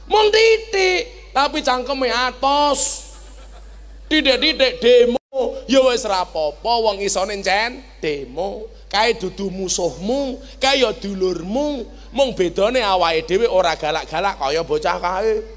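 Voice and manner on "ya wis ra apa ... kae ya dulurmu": ordinary talk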